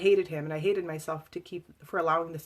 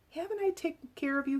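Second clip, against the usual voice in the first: much higher, fuming question; 11 semitones higher than usual. Retold or imitated. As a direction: spit it out in angry disbelief. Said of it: "he fumed".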